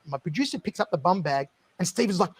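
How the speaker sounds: ordinary talk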